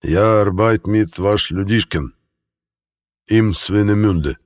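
put-on slow, heavily German-accented speech. A narrator's reading. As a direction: speak broken Russian like a German. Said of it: "он чудовищно коверкал слова".

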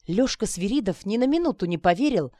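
ordinary delivery, nothing marked

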